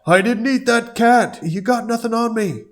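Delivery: high loud Alf protest. Acted. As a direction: yelp it out like a cornered puppet alien. Alf voiced in read script.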